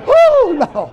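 loud, high-pitched comic wail; hollering in distress. Retold or imitated. Preacher acting the man caught with the wildcat, hollering in distress. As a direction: yell it like you're caught in a fight. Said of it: "he started hollering".

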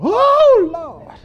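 high, loud wail that falls away; hollering in distress. Retold or imitated. Preacher retelling a comedian's wildcat story, hollering in distress. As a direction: holler it out like someone being mauled. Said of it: "he started hollering".

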